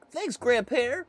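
put-on high little-kid thanks; squeaky grateful grandchild; child thanking grandpa for a book.

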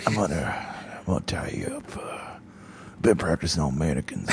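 low, hushed creepy murmur; lightly menacing, quiet and low; creepy kidnapper. Pretend. Creep imagining tying someone up, low and quiet. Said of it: "that has to be what he sounds like".

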